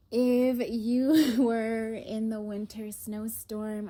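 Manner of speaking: laughing through the words. Real, not acted. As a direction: giggle while saying it. Laughs at own snow story.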